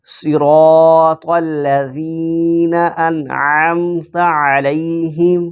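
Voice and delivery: teacher's model recitation; slow Quran recitation. Shown as a demonstration. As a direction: join صراط الذین انعمت علیہم slowly, long vowels. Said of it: "اکٹھا پڑھ لیتے ہیں".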